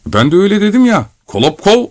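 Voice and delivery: high-pitched, hurt protest; offended. Acted. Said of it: "dedi gücenerek yaşlı adam". (gücenmiş, tiz bir sesle)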